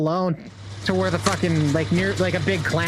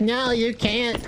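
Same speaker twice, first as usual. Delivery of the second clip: slow, higher whiny mock of nervous teammates; mocking scared teammates; 4 semitones higher, slower than usual. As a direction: drag it out like a timid player begging. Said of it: "They're like".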